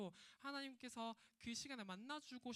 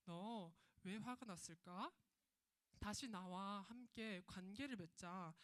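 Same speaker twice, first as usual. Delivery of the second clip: tender invitation; 3 semitones lower, slower than usual. Acted. God gently inviting angry Cain back, slow and tender. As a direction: coax Cain back kindly and slowly. 책망이 아니라.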